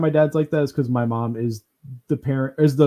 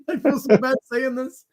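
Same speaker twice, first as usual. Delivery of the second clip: ordinary delivery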